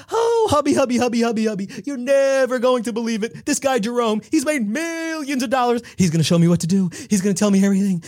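wife bursting with news for her husband, high and giddy; giddy gush. Acted. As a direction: gush to your husband, squealing with excitement. Kim gushing to her husband.

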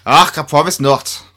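cheekily fakes a Scottish "Ach" while promising to stop; half-hearted Scottish accent. [name] teases Julia, promising to drop the act, in a light mock Scottish accent. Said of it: "laughter every time you attempt a Scottish accent".

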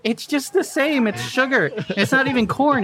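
amused high rant; laughing. Real, not acted. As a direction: laugh through the complaint. Laughing rant about ingredients.

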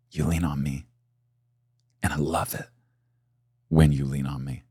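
very soft and low, close and intimate; hushed, tender voice of God; tender whisper. Acted. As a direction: breathe it gently like a loving father. God speaking tenderly to him.

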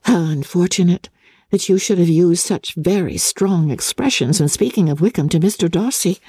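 a lightly voiced lively young woman; hint of a spirited sister; Elizabeth's voice. Elizabeth confides in Jane.

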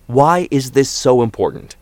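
father challenging his son; demanding father. A narrator's reading. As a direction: press for an answer, stretching the words.

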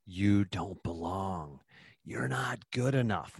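very slow, hushed whisper of self-doubt; podcast host voicing the listener's quiet doubting inner voice; undermining inner voice. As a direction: drag it out softly like a voice creeping into your head. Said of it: "that voice in you as well that says".

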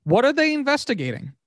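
high-pitched, exasperated disbelief; incredulous question. Real, not acted. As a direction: shoot the pitch up, baffled. Incredulous question about slow investigation.

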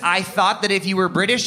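normal talking voice

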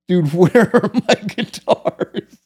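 half-laughing confrontation of a friend; laughing disbelief. Retold or imitated. Confronting the friend who stole guitars.

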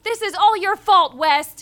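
hammed-up furious accusation; mock angry shout. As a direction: blast the blame at West. Mother blames West.

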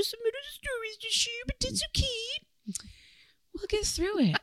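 high, soft, breathy put-on voice. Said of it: "I love this voice".

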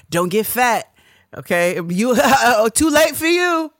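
high, loud laughing taunt; teasing elder. Retold or imitated. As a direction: taunt them with a laughing shout.

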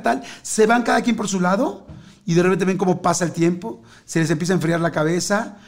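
ordinary talk

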